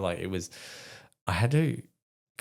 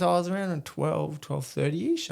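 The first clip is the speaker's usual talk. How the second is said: higher and fast, a light take on his wife; chirpy, quick higher voice for the wife; wife's voice; 10 semitones higher than usual. Retold or imitated. Retelling his wife's casual remark in a higher voice.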